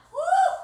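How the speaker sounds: loud, high celebratory woo; cheering woo. Real, not acted. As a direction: whoop to celebrate the finish. Set finished, cheering.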